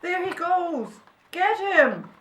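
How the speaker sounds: high, loud shout in pursuit; chase cry. A narrator's reading. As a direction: yell to the others to grab him. Helpers chasing the badger.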